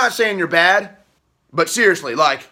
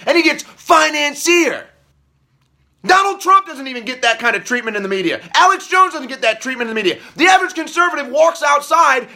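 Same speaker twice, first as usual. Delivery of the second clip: higher, fast, furious ranting with shouted peaks; real anger; 6 semitones higher than usual. Real, not acted. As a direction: let the fury spill out. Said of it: "I'm just fucking pissed".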